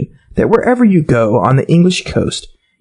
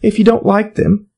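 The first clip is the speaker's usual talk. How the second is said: calling softly; 9 semitones higher than usual. A narrator's reading. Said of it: "she called softly after it".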